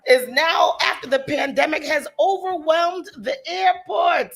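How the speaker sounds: put-on loud, slow Jamaican accent. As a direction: read the news in a Jamaican accent. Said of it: "That's my Jamaican accent".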